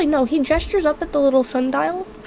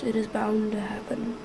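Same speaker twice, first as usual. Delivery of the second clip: whispered \"It is bound to happen\" under the breath; 3 semitones lower, 9 dB quieter than usual. Shown as a demonstration. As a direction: let it fall to a hushed whisper. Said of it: "repeated line, second take whispered".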